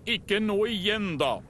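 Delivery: high, slow groaning complaint; exasperated exclamation. A narrator's reading. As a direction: exclaim it in a put-on character voice. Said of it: "utbrøt Freddy".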